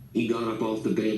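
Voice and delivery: ordinary delivery, nothing marked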